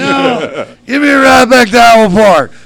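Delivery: high, loud shout; shouting refusal. Retold or imitated. Bloodied man refuses and demands a ride home, loud shout. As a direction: yell it back in protest.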